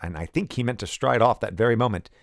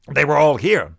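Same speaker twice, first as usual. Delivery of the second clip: heated insistence; 7 semitones higher, 9 dB louder than usual. Acted. General pointing at emptied shelves.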